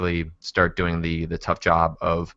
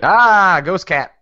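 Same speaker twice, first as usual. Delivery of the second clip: sudden high excited cry; excited call; 11 semitones higher, 8 dB louder, much slower than usual. Real, not acted. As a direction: blurt it out, thrilled. Sudden call of the ghost cat.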